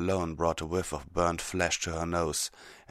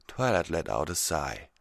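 ordinary delivery, nothing marked

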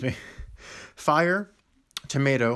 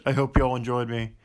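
ordinary delivery

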